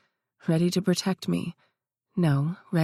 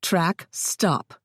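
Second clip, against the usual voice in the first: snapped. A narrator's reading. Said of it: "Sugar snapped".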